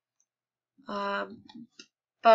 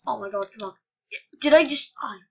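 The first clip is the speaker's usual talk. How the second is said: ordinary talk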